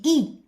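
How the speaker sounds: letter Y pronounced "I", as in French "il"; "I" sounded as a clear ee; Y read as I. Shown as a demonstration. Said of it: "هنا Y".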